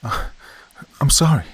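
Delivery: high, loud and panicked; frightened apology. A narrator's reading. Wife apologizing to her dead husband's ghost, terrified. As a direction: blurt it out in terror.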